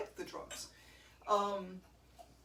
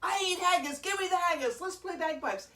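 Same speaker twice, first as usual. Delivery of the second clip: higher, louder over-eager tourist; mock excited American; 8 semitones higher, 6 dB louder than usual. Kilt seller imitating over-eager American visitors. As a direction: gush like a clueless fan. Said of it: "and you're all like".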